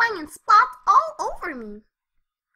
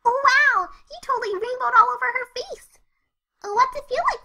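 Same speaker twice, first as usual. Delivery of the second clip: excited Pinkie; 6 semitones higher, 4 dB louder than usual. Acted.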